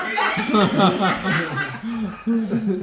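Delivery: big burst of real laughter; loud laugh. Real, not acted. Comic laughing at own punchline. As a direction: crack up at the joke.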